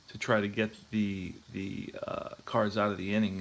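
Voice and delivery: ordinary delivery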